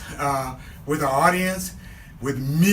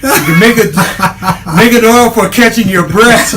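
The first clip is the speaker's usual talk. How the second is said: laughing; 5 semitones higher, 10 dB louder than usual. Real, not acted. Laughing joke after exercise.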